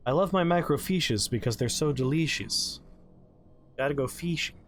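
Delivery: "delicious" as "deleeshus", "fishing" as "feeshing"; punning pronunciation. Pretend. Joking about microfiches, "delicious" and "fishing" said with a long ee.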